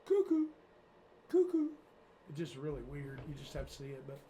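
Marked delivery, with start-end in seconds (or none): 0.1-1.7 high, sing-song cuckoo call; creepy villain. Retold or imitated. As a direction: imitate the killer from the movie. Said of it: "and he goes".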